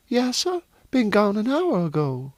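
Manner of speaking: high-pitched servant woman's voice. Acted. Servant answers master about her boy, high character voice.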